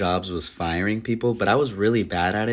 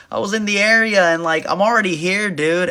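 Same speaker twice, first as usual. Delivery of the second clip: higher, louder casual dude; mock dude voice; 9 semitones higher, 4 dB louder than usual. [name] explaining why he's still there, higher casual dude voice. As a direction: shrug it off like no big deal.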